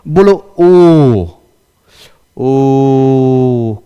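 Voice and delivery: ओ held long and slow, pure vowel; teacher sustains ओ to show a pure vowel; drawn-out vowel. Shown as a demonstration. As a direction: hold ओ steady, nothing else sounding. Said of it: "अच्छा, एक और बोलो".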